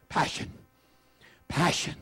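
"Passion" said softly and low, dropped down; "Passion" breathed out quietly with reverence; soft hushed word. Shown as a demonstration. Preacher softly modelling "Passion" for the church. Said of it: "say that word with me".

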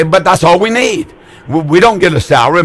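normal talking voice

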